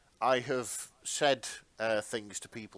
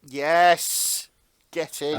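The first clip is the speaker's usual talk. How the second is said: excited cheer; 5 semitones higher, 13 dB louder, much slower than usual. Real, not acted. Cheering the pun punchline.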